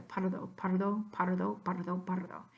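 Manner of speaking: pardo said as potter-doe, the R a quick D-like tap; tap through D. Shown as a demonstration. Teacher shows the compromise for students, pardo as potter-doe. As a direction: run pardo together so the R flaps. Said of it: "If you say that together".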